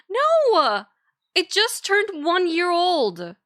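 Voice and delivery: high, indignant no. Real, not acted. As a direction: protest in disbelief. Indignant denial.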